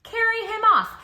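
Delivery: ordinary delivery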